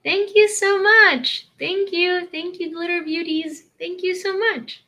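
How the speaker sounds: excited high thank you; gushing thanks. Real, not acted. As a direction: pour out thanks to the donors. Thanking donors on livestream.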